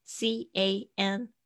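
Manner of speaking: slow, separate letters for "C A N"; spelled out slowly. Shown as a demonstration. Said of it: "特别注意一下can的发音". (慢慢拼出字母)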